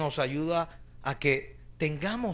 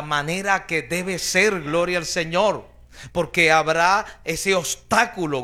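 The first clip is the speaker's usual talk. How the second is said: louder and louder, pounding out each line to the church; full-force preaching; 6 dB louder than usual. Acted. Preacher urging the congregation to empty their souls, fervent loud preaching. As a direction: preach it hard, building to a roar.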